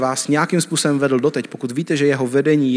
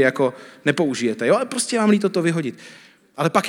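usual speaking voice

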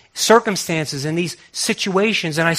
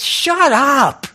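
retold shout; 10 semitones higher, 7 dB louder, much slower than usual. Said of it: "he said".

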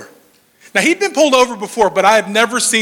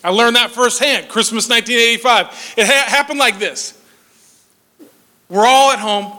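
ordinary talk

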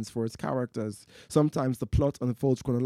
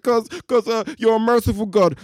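desperate stammer; 9 semitones higher, 8 dB louder than usual. Acted. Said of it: "The atheist is like".

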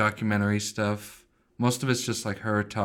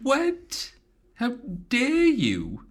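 very high squeaky indignation; mock outrage; much slower than usual. Co-host defends New York from the insult, mock offended. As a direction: play deeply offended in a falsetto.

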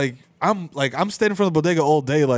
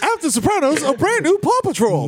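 put-on kids TV announcer; 12 semitones higher than usual. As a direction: sell it like a cartoon channel promo. Mock TV announcer.

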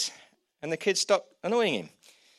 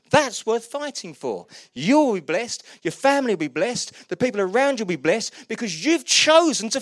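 louder and higher, rousing the room; preaching at full force; 8 semitones higher, 7 dB louder than usual. Acted. Preacher rallying the congregation to fight, rousing preaching. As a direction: build it up like a revival call.

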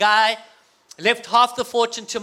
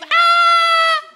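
loud, high, long fake pain scream; staged scream; 7 dB louder than usual. Boy fakes a beating for mum's ears, staged scream. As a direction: hold a hammed-up scream at full volume. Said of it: "I would scream".

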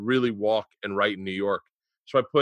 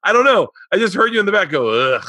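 flustered high defence; 8 semitones higher, 6 dB louder than usual. Retold or imitated. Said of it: "And I was like".

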